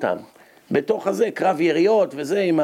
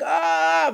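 retold cry; 11 semitones higher than usual. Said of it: "והוא צועק".

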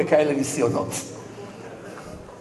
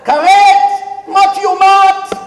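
mock screaming; 11 semitones higher, 8 dB louder than usual. Wife screams behind husband's car to stop him, hammed-up shrieking. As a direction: scream the warnings like a hysterical alarm. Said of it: "תתחילי לצרוח".

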